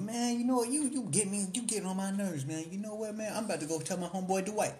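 hammed-up annoyed comedian; Mike Epps impression. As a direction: whine it out, fed up. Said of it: "he's like".

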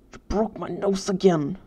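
pained whine, lightly acted; whining. A narrator's reading. Pinned kid complains about his broken nose, whining. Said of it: "Cody whined".

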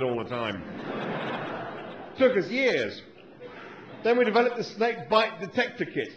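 a fussy, grumbling Australian scientist; Australian accent. Acted. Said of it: "my Australian accent isn't very good".

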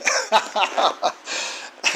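breathy, high laugh of disbelief; scornful laugh. Real, not acted. Laughing at the area's vote, a high scornful laugh. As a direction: laugh it off in contempt. Laughs after racist remark.

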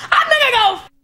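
mock crazed shout, starting high; gleefully unhinged. Comic waking up one day to go nuts, mock crazed shout. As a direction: announce it like someone happily snapping. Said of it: "you just wake up and go".

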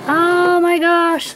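high, drawn-out squeal of delight; real excited squeal; thrilled. Real, not acted. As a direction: stretch it out in high, delighted amazement. Horse fits in the new trailer.

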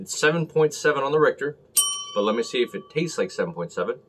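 mug at lips; speaking between sips; talking while sipping beer. Real, not acted. Seen 0: Dark beer mug held at lips, sipping throughout the labelled words.

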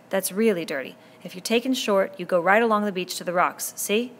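put-on a boy leader speaking up firmly to a noisy crowd; Ralph addressing the boys. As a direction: raise your voice to the assembly and hold their attention.

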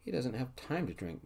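ordinary delivery, nothing marked

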